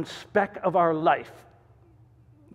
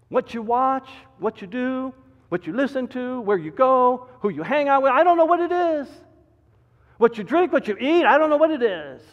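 rising preaching; 7 semitones higher, 6 dB louder than usual. Acted. Preaching at full force.

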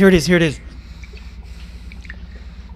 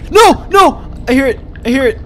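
loud, high cries of alarm; panicked shouting; 8 semitones higher, 6 dB louder than usual. Real, not acted. Player hears the monster, panicked shouting. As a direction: yell in real panic. Sudden threat heard in game.

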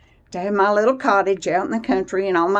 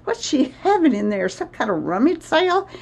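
mock-exasperated, a lot higher; exasperated workmen; 8 semitones higher than usual. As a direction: play the workers, amazed at the clutter. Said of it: "I'm sure they're going".

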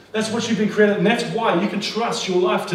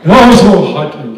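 a sniffy, put-on voice, louder; mock bored churchgoer; 3 semitones lower, 6 dB louder, slower than usual. Preacher mocking pew-sitters who call worship hype, in a sniffy voice. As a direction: send up the unimpressed churchgoer. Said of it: "then they come to church and like".

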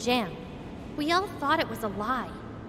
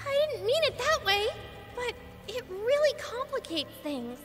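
high, hurried-to-placate protest; back-pedal in a raised pitch; flustered defence; 7 semitones higher than usual. Acted. Denying she finds demons shameful, flustered.

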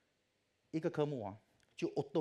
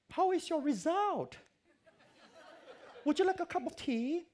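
put-on much higher, slow, sweet old lady voice; British lecturer; 6 dB louder, slower than usual. Elderly lecturer greets anxious student, high sweet English voice. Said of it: "英国人很喜欢讲这样啊".

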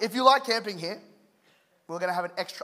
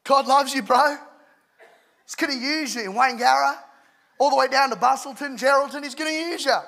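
high, loud Australian preaching voice; Aussie preacher; 7 semitones higher, 6 dB louder than usual. Shown as a demonstration. Showing Australian accents fail at preaching, loud Aussie accent.

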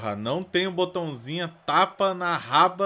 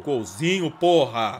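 usual speaking voice

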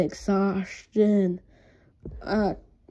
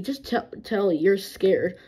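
normal talking voice

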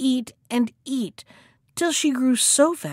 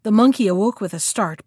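ordinary delivery, nothing marked

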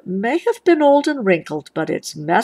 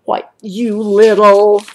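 louder, cut-off angry snap; angry outburst; 7 dB louder than usual. A narrator's reading. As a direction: lunge at your sister with it. Brother turns on his sister.

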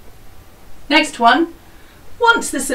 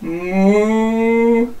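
cow moo. Shown as a demonstration. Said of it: "Jersey cow".

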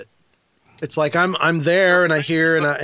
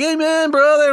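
mock congregant; 11 semitones higher, 3 dB louder than usual. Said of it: "some guys are like".

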